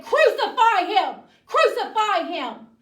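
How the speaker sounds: high, full-force yell; mob shouting. Acted. The crowd tells Pilate what to do, shouting. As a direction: shout the demand like an angry crowd.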